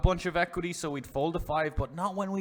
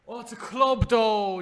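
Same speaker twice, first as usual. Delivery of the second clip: higher and louder, a crushed "oh"; dismayed surprise; 8 semitones higher, 8 dB louder than usual. Real, not acted. Streamer reacts as the river card ruins his hand, dismayed. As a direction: voice jumps when the club lands.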